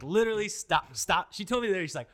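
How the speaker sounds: loud, high exasperated mock scold; imitating wife shouting stop whining; wife snapping. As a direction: play the fed-up wife telling him off.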